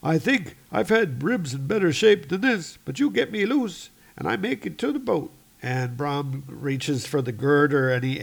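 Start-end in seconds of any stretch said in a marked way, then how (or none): 0.0-5.3 high, strained injured crewman; pitched-up voice, bargaining through the hurt; Arnie. Acted. Trapped man asking to be freed, high and strained.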